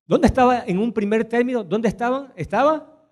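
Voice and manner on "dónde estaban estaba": ordinary talk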